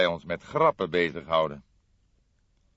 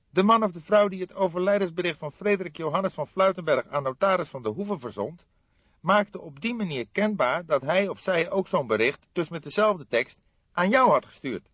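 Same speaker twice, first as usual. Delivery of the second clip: lightly voiced aggrieved young man, higher; a young colleague, a touch higher and put out; young detective voice; 12 semitones higher, 8 dB louder than usual. Fledder protesting to his older colleague, lightly voiced aggrieved.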